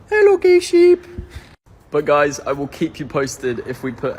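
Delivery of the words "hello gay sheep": high, loud cooing greeting. Real, not acted. Greeting the rainbow sheep in high baby talk. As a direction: coo hello in a squeaky voice.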